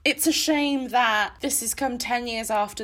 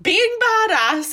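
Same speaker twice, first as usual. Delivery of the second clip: retold rapture; 9 semitones higher, 4 dB louder than usual. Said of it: "I was like".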